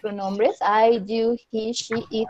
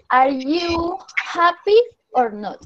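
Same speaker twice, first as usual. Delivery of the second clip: slow, higher, clearly spaced for learners; slow teacher model question; 6 semitones higher, slower than usual. Shown as a demonstration. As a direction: spell the question out slowly like a teacher. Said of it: "Entonces tú me vas a decir".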